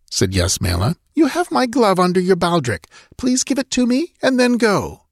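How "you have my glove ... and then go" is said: high-pitched royal woman's voice; imperious princess. A narrator's reading. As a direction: dismiss a nobleman with controlled command. Princess dismisses the count.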